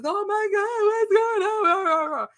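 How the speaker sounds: high, slowed alarmed cry; alarmed husband. Retold or imitated. Husband running downstairs at the fight, high alarmed cry. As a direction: imitate him rushing in panicked.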